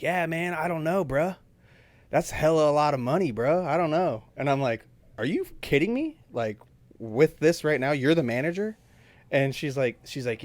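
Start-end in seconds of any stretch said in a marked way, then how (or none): 0.0-4.2 lightly imitated, higher "bruh" talk; a hint of an unhelpful manager shrugging it off; mock casual manager, pitched up; slack manager. Retold unprofessional manager, bro talk. 5.2-8.8 high-pitched retold disbelief; imitated indignant question, pitched up; incredulous.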